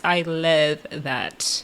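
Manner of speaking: very slow, low and soft; low, lingering emphasis on "love"; drawn-out approval. Real, not acted. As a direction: stretch LOVE right out, savoring it.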